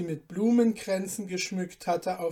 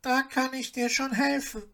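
a gentle helper reassuring the dwarf; mild knowing question; calm goose; 7 semitones higher than usual. Acted.

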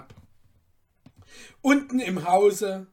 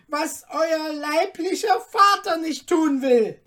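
much higher, louder harsh interruption; cutting in; 11 semitones higher, 11 dB louder than usual. Acted. Merchant interrupting the cutler, harsh. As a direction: cut him off sharply.

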